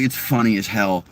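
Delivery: ordinary talk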